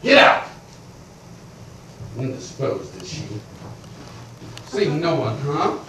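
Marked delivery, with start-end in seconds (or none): 2.2-5.8 low, quiet sneering repeat; mocking echo. Creditor mocking the servant's excuse, low and sneering. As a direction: sneer back his words.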